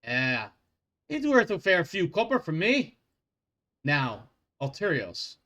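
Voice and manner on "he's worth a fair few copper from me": put-on Scottish accent. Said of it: "a Scottish accent".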